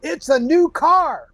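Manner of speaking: slow, high, loud game show reveal; booming prize announcer. Pretend.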